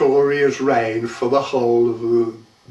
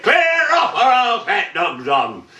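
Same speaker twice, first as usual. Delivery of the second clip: raised-pitch warning, mildly fierce; ordering off; 9 semitones higher than usual. A narrator's reading. Tenant farmer warns soldiers off his farm, ordering. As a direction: order the intruders off. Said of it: "he ordered double quick".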